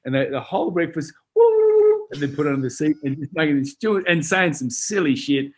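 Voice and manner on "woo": high, loud sustained whoop; piercing drawn-out hoot; prank whoop. Retold or imitated. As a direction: let out a long, high woo. Recreating noise made into walkie-talkie.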